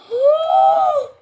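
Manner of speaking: high, loud gasp; excited gasp. Real, not acted. Streamer first sees the new update, excited gasp. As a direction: gasp loudly in delight. Update has just appeared.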